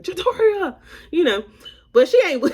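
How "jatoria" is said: mom calling name. Retold or imitated. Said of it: "She was just like".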